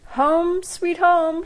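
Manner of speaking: high, loud sing-song chime. A narrator's reading. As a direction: chime it out cheerfully. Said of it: "she chimed".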